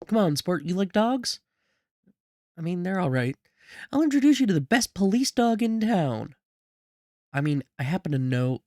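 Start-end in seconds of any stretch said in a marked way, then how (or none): 0.1-6.3 high-pitched, upbeat coaxing; high, friendly grown-up talking to a child. Acted. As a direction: pitch it up to win over a kid. Character coaxes child toward dogs.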